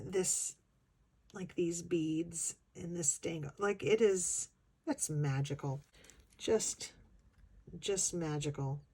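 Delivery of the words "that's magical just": usual speaking voice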